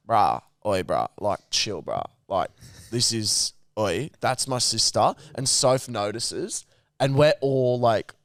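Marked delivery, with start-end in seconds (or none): 0.1-5.1 low, laid-back mock bloke voice; drunk brother. Drunk brother telling the angry guy to chill, low and casual. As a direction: brush him off, dropping the voice low. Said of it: "And he's going".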